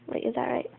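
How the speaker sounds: breathy unvoiced question; whisper. Real, not acted. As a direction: whisper it to yourself. Unsure aside.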